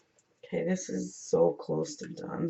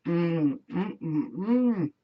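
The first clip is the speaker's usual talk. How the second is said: pleased mm while tasting; savouring hums; much slower than usual. Real, not acted. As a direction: hum with pleasure at the taste. Savouring candy.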